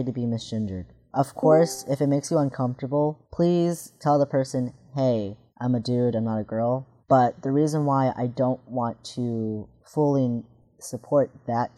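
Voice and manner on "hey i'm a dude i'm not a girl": ordinary talk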